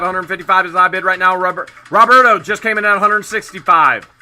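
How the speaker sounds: fast, rising auctioneer call. Real, not acted. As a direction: build the bidding to a shouting climax. Live auction countdown calling.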